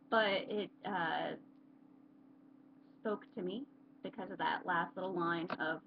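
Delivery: normal talking voice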